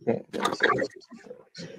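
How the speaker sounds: breathy whispered aside. Shown as a demonstration. Cohost repeats the dig, now whispered. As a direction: whisper it like a secret jab. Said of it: "repeated as a whisper".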